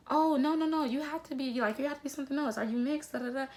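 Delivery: high-pitched, insistent mock disbelief; disbelieving questioner. People doubting her answer, disbelieving and pushy. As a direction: mimic someone refusing to accept the answer.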